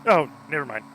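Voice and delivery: loud, high startled outburst; startled shout. Acted. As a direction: blurt it out in shock. Startled realisation in game.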